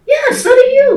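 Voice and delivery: mock-indignant comeback to a stranger; sassy comeback. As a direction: fire it back with a sassy lilt. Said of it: "my response is normally".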